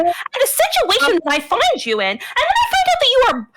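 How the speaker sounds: high, emphatic indignant rebuke; indignant scolding. Acted. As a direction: scold a wayward brother, pitched high. Sister scolds brother in character.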